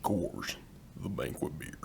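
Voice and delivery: very low, quiet voice; as low as the voice can go; deep voice. Shown as a demonstration. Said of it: "the lowest I can go".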